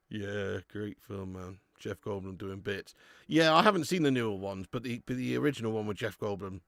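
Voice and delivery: normal talking voice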